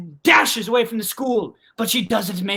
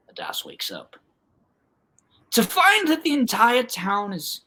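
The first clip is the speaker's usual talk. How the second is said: ordinary delivery, nothing marked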